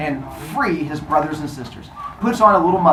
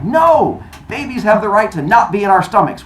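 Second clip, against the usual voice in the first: raised, mildly indignant objection; protesting; 5 semitones higher, 5 dB louder than usual. Acted. Zeus arguing against eating babies, raised protest. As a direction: start on a high, loud no and argue on.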